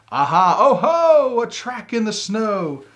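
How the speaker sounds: loud, high, thrilled cry of discovery; excited discovery. Acted. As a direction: shout it out like you've found a clue. Gruffalo's child discovering tracks.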